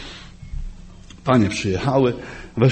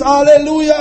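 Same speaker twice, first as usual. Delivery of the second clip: high, louder, bright exclamation of praise; joyful hallelujah; 8 semitones higher, 4 dB louder than usual. Retold or imitated. Preacher acting a believer's good day, joyful. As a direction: show the good day, full of joy. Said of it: "Że jeden dzień jest".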